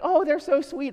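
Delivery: high, sugary coo imitating strangers; gushing onlooker. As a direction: coo over the kids like a charmed stranger. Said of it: "they're like".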